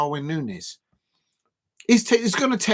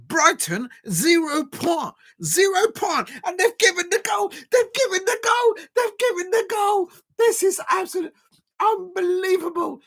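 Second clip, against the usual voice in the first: outraged shouting; 12 semitones higher than usual. Real, not acted. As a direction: yell it in outrage at the referee. Outrage at goal being given.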